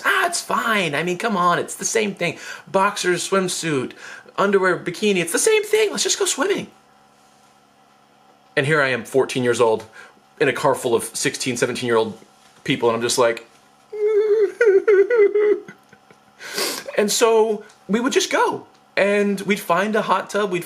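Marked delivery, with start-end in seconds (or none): 0.0-6.7 high voice climbing into a squeak by the end; insistent persuading. Retold or imitated. Brother waving off the lack of swimsuits, insistent. Brother talking friends into swimming. 13.9-15.7 very high-pitched mock-nervous giggling; squeaky acted giggle of a younger kid; high giddy giggle. Fourteen-year-old squeezed in with older teens, giddy giggle.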